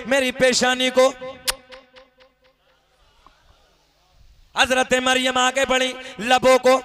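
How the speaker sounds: loud, high proclamation; fervent sermon proclamation; full-force preaching. Acted. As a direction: thunder it out to the gathering. Preacher's climactic narration.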